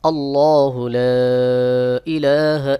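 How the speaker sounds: slow, measured Quran recitation. Shown as a demonstration. Teacher reciting the whole verse for students. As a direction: recite the line slowly and clearly. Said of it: "যেমন".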